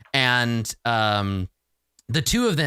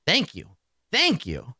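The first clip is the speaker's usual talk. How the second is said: a lightly raised, higher thank-you; bow it out twice, a notch higher; Chukka thanking; 7 semitones higher than usual. Acted. Chukka thanking the caster after the fix, lightly higher. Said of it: "uh, and says".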